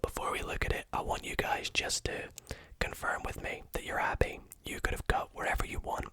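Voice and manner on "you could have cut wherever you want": ordinary delivery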